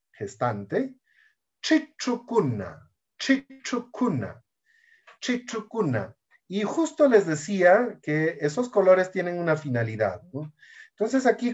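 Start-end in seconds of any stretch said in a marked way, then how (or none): 1.6-6.2 slow "Chichucuna", tongue curled back on each ch; retroflex ch. Shown as a demonstration. Teacher modelling a plural for learners, retroflex ch.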